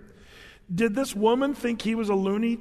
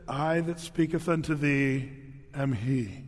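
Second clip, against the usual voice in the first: slow, low, lofty pronouncement; lightly voiced grand false messiah; slow archaic proclamation; mock solemn prophet; 4 semitones lower, slower than usual. Man claiming to be Messiah.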